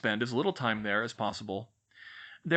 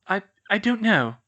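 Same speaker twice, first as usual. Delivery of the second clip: halting, tearful stammer on I, I; a girl stammering close to tears; 8 semitones higher, 7 dB louder than usual. Acted. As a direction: stumble over the words, upset. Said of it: "she stammered".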